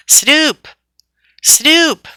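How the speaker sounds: high, loud call. Acted. Bert calling the kitten through a hole in the roof, loud high call. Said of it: "he called".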